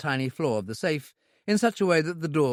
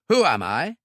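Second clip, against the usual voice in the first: menacing question; 3 semitones higher, 6 dB louder than usual. A narrator's reading. Gunman demands the owner know him, loud menace. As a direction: press him hard. Repeated interrogation.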